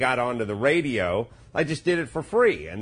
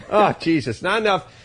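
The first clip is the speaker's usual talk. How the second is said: higher, louder laughing rant; laughing exasperation; 6 semitones higher, 5 dB louder than usual. Real, not acted. Dad fed up with morning cartoons, laughing rant.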